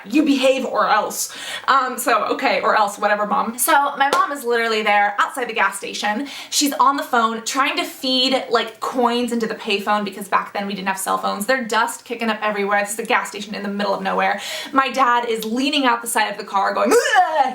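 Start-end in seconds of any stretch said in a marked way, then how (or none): 0.1-1.3 slow, mock-stern mom warning; parental threat. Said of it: "That was always the threat". 16.9-17.5 loud, high imitation barf; retching noise. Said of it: "He's, like, barfing all over the place".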